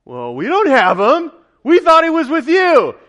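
high, loud reenacted panic; rising shout of dismay; alarmed reply. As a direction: cry it out as the startled friends. Friends' alarmed answer acted out.

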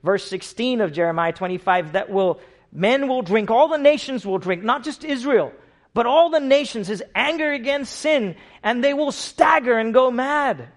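loud, high, driving sermon delivery; loud, high pulpit preaching; full-force preaching. Acted. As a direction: proclaim it to the whole room.

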